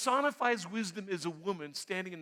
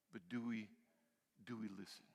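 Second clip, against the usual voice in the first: much lower and softer, almost to himself; hushed question; 10 semitones lower, 14 dB quieter than usual. Real, not acted. Preacher asking the congregation if they listen, hushed. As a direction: let the question sink low and quiet. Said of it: "rhetorical question dropped low".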